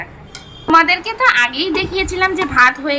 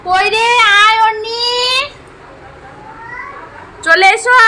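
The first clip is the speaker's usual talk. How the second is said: raised voice summoning a little one; 9 semitones higher, slower than usual. Real, not acted. Calling the child over.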